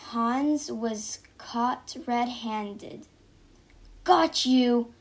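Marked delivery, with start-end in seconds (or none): 4.0-4.9 loud triumphant shout. A narrator's reading. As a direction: bark it as you catch him. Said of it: "shouted the policeman".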